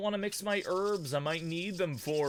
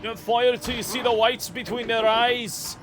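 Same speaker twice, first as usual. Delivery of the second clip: loud, raised commander's cry; hammed-up rallying shout; mock battle command; 5 semitones higher, 6 dB louder than usual. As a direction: call it out like an order to the troops. Mock battle command during gunfight.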